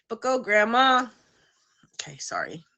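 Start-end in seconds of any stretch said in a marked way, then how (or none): 0.1-1.1 loud, higher chant cheering Grandma on; goofy cheer. Shown as a demonstration. Host cheers for Grandma sitting up, as a loud goofy chant. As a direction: cheer her on like a silly fan. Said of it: "that's the dumb stuff that I do".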